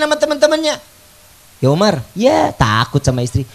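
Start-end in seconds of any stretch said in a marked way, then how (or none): 1.6-3.5 teasing friends. Acted. Friends tease Umar about his wife.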